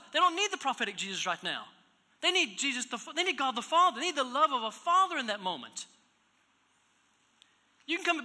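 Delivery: a preacher pressing the congregation, loud and high; full-force preaching. Acted. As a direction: drive the point home from the pulpit.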